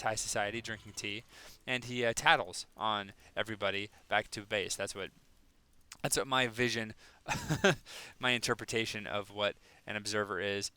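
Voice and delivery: ordinary talk, nothing marked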